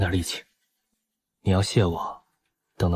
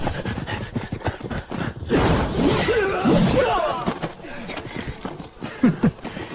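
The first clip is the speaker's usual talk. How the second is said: 反派狂笑；尖声得意的狞笑；笑得张狂又阴狠；反派得意狂笑. Acted.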